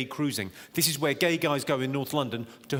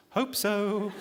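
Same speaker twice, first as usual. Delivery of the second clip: lightly voiced passenger, pitched up; unsure passenger; 4 semitones higher than usual. Fellow passenger asked if it's the right train, unsure answer. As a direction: answer in a higher put-on voice.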